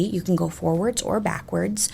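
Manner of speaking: ordinary talk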